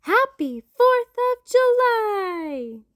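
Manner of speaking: high, loud, slow celebration; cheering shout. Acted. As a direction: shout it to the sky. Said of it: "we all cheered and shouted".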